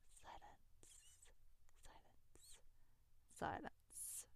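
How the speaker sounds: hushed dramatic whisper; fading whispered echo. Real, not acted. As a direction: breathe it out like an echo.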